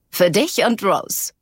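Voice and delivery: slow, amused, with a grin in the voice; lightly voiced playful friend; grinning. A narrator's reading. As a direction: hand it over grinning. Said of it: "sagt sie grinsend".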